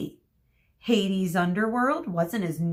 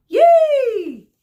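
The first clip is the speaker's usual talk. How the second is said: shrill high yay; cheer; 15 dB louder than usual. A narrator's reading. Group B cheering the announcement, a loud high yay. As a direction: squeal with delight. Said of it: "Group B cheered".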